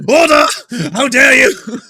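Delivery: very high, very loud outraged bellow; a hammed-up outraged parliamentary shout; mock Speaker shout. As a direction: roar for order like the Speaker.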